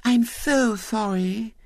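put-on very high, drawn-out girlish sympathy; sugar-sweet piping pity; Violet Elizabeth, sweetly. A narrator's reading. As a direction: coo slowly like a lisping little girl. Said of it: "said Violet Elizabeth sweetly".

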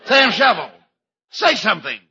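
shocked cop calling his friend; 1930s beat cop. Acted. Lieutenant shocked by kiss.

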